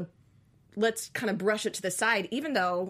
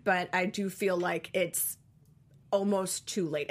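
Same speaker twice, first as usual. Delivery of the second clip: ordinary talk, nothing marked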